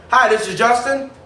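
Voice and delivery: upbeat phone greeting. Shown as a demonstration. Salesman answering an unknown caller, upbeat. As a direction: greet a caller like you're happy to hear him. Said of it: "I'm like".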